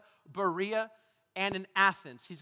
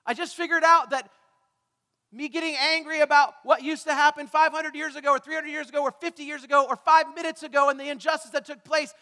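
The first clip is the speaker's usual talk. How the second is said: raised, loud voice of frustration; shouting out; 5 semitones higher, 8 dB louder than usual. Acted. People angry at injustice, crying out loudly. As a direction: pour out the complaint loudly. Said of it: "Who are still shouting out".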